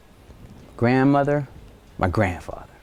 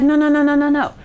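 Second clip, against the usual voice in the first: hasty denial; 11 semitones higher, faster than usual. Retold or imitated. Folks hastily denying.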